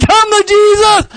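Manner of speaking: a high, strained call to come to Jesus; high pleading. Acted. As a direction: reach way up and plead. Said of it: "keep pleading".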